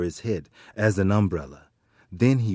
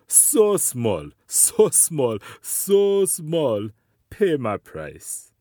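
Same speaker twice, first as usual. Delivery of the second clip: scornful, rising higher and louder; mocking; 4 semitones higher, 3 dB louder, slower than usual. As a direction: sneer at the small spider. Mocking sky god.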